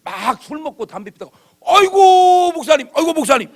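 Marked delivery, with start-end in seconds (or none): 1.6-3.5 high, loud, gushing greeting; fawning drunk greeting. Retold or imitated. Drunk man on the street greeting the pastor, high and gushing. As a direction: play the tipsy man bowing to the pastor.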